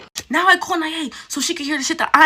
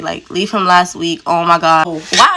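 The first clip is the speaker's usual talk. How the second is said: ordinary talk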